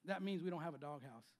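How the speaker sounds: normal talking voice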